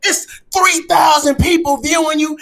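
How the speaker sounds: usual speaking voice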